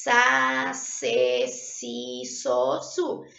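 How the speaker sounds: "Sa, se, si, so, su" each with a clear hissing S as in saudável; syllables with S sound. Shown as a demonstration. Teacher sounding out syllables for pupils, crisp S in "Sa, se, si, so, su". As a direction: sound out "Sa, se, si, so, su" slowly with a sharp S. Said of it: "Esse som aqui, ó".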